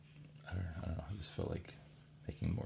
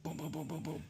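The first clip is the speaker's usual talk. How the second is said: light high-pitched booms; imitated booms; 9 semitones higher than usual. Shown as a demonstration. Drawing spikes, showing the rhythm with high booms. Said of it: "You know, like that".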